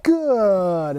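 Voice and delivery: long, evenly stretched "Good", falling steadily; drawn-out marker word. Shown as a demonstration. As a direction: stretch "Good" out slowly and let it glide down. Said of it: "That good means it's gonna go".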